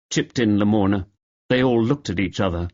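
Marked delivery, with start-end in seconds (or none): none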